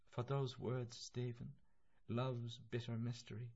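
a hint of a frail, tender mother; lightly voiced mother. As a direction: say it softly to a son. Mother's voice remembered in narration.

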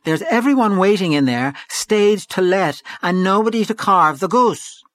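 high, slow, stretched-out wail; loud, high, exasperated lament; despairing cry. A narrator's reading. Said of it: "she cried".

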